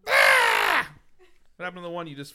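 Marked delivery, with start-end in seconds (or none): 0.1-0.9 loud, high-pitched "Ah!"; sudden yell. Real, not acted. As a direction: let out a sharp, high cry. Sudden cry while reading entries.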